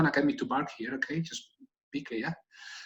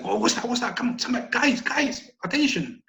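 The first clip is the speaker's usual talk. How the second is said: alarmed dog; 7 semitones higher than usual. Retold or imitated. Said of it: "a bit more of an alarm bark".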